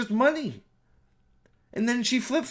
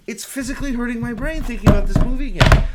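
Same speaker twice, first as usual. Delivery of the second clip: frustrated outburst; 4 semitones higher, 8 dB louder, faster than usual. Real, not acted. Frustrated rant about the movie.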